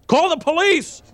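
second neighbour shouting for the police; another man shouting. Acted. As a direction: yell it down the hall as a different man. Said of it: "shouted another man".

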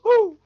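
loud, high-pitched "woo!"; whooping cheer. Pretend. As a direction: let out a big rallying whoop. Cheering on Scotland in put-on voice.

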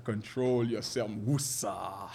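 slow, soothing hush; calm him like a therapist would. Retold or imitated. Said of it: "like a therapist".

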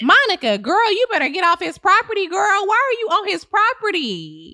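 high, sassy scolding; sassy realtor. Acted. Realtor siding with the tenant, high and sassy. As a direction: scold her like a smug realtor.

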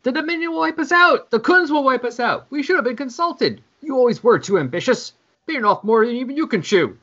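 high shouting in panic; panicked screaming. Acted. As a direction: scream over each other in panic. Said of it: "everyone just starts screaming".

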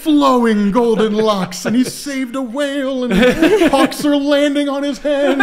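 high, slow, dreamy swooning; mock adoration. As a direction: gush over him like a fangirl. Swooning description of Aquaman.

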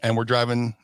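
ordinary talk, nothing marked

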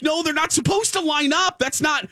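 high-pitched, retold objection; alarmed protest. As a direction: protest in mock panic, pitch jumping up. Retold protest at people lining up.

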